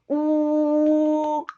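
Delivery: a held U with the pencil pressed against the teeth; vowel U with pencil. Shown as a demonstration. Teacher showing students the closed vowel U. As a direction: round the lips on the U, teeth closed.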